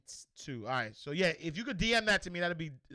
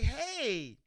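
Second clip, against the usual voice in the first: put-on much higher, slow, sing-song kid voice; daughter voice again; 8 semitones higher, slower than usual. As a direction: tease like a child with a secret. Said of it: "She was like".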